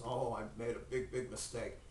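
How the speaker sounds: low, soft admission of fault; contrite. Acted. Abraham admitting his mistake to God, quietly contrite. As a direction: sink into quiet, mild regret.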